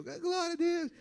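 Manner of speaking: high-pitched exclamation of praise; mock praise shout. Churchgoer in a packed van of believers, mock jubilant.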